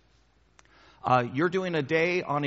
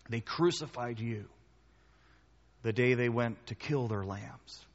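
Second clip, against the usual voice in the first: prayer. Real, not acted. Leads closing prayer.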